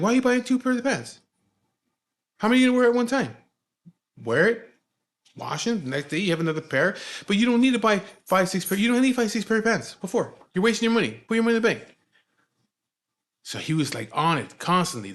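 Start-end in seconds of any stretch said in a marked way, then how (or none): 0.0-12.0 high, loud mock lecture; exasperated scolding. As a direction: imitate a strict elder nagging in disbelief. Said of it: "he would say shit like that".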